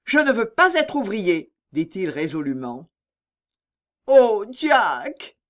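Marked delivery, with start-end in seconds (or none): 0.1-1.5 higher, louder, determined refusal; firm up the voice and refuse; resolute, firmed voice. A narrator's reading. Jack stands up to his parents, resolute. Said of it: "raffermissant sa voix". 4.1-5.4 high, dismayed exclamation; faint, dismayed murmur. A narrator's reading. Charlotte reacts to her son's refusal, faint with dismay. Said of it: "murmura Charlotte défaillante".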